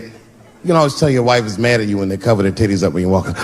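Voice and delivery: ordinary talk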